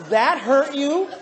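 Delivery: loud, drawn-out disbelieving question; incredulous. Retold or imitated. Husband questioning wife's complaint, loudly incredulous. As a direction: stretch each word in loud disbelief. Incredulous retort in retold argument.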